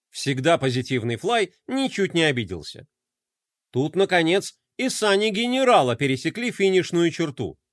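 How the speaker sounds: ordinary delivery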